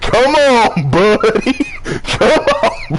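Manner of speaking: high-pitched mocking encouragement; high mock coaxing. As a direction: coax it up high, teasing.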